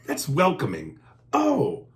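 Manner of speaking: ordinary delivery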